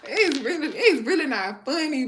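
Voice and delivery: laughing while insisting it's not funny; real giggles breaking through the words. Real, not acted. Laughing through a serious point.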